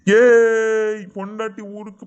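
loud, drawn-out whoop, then easing; gleeful husband's yell; jubilant cheer. Acted. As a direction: celebrate sudden freedom with a long shout. Husband celebrates once wife has left.

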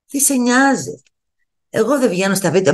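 normal talking voice

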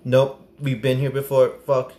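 ordinary talk

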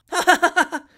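high, loud acted ha-ha-ha; roaring laugh. Servant roaring at the gamekeeper's joke, roaring laugh. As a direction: burst into a loud staccato laugh. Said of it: "roared Mister Weller".

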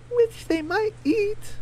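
very high, slow chicken voice; squeaky-high storybook hen asking the group to split up; high Daisy voice. Acted.

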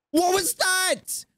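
very high, slow, drawn-out squeak; squeaky mock voice. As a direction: repeat the question in a silly high voice, stretching it. High squeaky repeat of the question.

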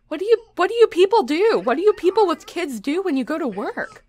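high, loud bewildered outcry; loud, high-pitched desperation; exasperated plea. Real, not acted. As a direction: wail the question at the audience. Desperately asks parents in the audience.

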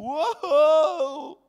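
loud high whoa of mock amazement; mock awed whoa. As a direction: shout out an exaggerated wow. Mock crowd reaction.

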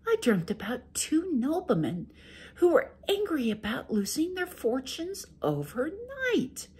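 high sweet wife making excuses. Acted. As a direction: play innocent to the ogre, high and gentle. Wife tells her dream.

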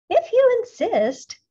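very high, loud and slow; drawn-out insect menace; praying mantis voice. A narrator's reading. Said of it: "said the praying mantis".

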